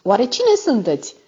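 mildly astonished question, pitched high; wondering. A narrator's reading. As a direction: ask in wonder with a lifted voice. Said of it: "mirându-se".

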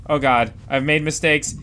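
usual speaking voice

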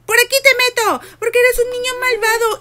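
high, loud mock scolding. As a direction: scold the naughty baby. Regaña al bebé malvado. (regaño fingido al bebé malo)

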